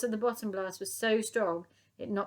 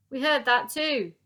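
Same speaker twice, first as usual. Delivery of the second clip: loud, higher police call; shouting; 6 semitones higher, 10 dB louder than usual. Acted. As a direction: call it through the door. Police through door.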